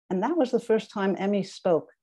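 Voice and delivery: usual speaking voice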